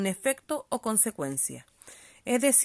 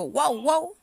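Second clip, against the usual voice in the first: barking dog; 5 semitones higher, 6 dB louder than usual. Shown as a demonstration. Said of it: "cuando digo".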